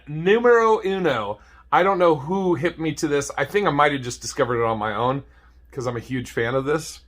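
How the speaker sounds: usual speaking voice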